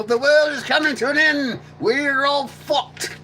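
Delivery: high, loud, slow doomsday yell; hammed-up doom prophet; doom screaming. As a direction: proclaim the end of the world. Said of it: "screaming at people".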